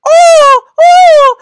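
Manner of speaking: high, loud mock moans; imitated moaning noises. Storyteller mimicking a guy doing ab exercises, loud high-pitched moaning. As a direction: squeal out two long, loud moans. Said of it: "fucking moaning noises".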